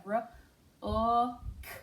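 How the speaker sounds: "r, o, k" as separate sounds, the "o" loud and held; teacher stretching out each sound in "r, o, k". Shown as a demonstration. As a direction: segment "r, o, k" sound by sound. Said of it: "Okay, two syllables. We have".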